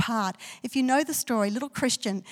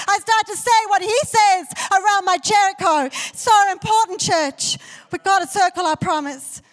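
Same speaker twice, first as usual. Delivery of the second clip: loud, high, ringing preacher's declaration; full-force preaching; 8 semitones higher, 8 dB louder than usual. Acted. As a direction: proclaim it over the church at full power.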